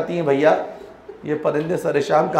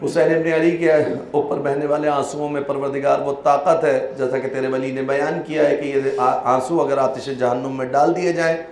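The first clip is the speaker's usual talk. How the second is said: prayer; 3 semitones lower, 5 dB quieter than usual. Acted. Preacher leading the mourners in closing dua, soft. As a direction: lower and softer supplication.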